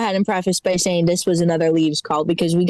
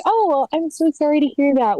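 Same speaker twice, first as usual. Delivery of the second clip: lightly acted sympathetic receptionist, higher; high-pitched customer-service politeness, played lightly; polite customer service; 6 semitones higher than usual. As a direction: put on a mock-apologetic phone manner. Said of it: "you just wanna be like".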